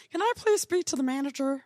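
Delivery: lightly voiced Karen. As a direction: put on a touch of entitled customer. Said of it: "she's like a Karen".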